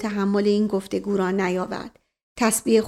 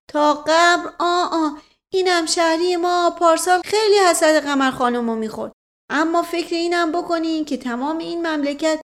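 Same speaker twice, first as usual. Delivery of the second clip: a simple village man swearing he's honest, raised and eager; rustic old servant voice; 6 semitones higher than usual. Acted. Voices Mash Qasem again.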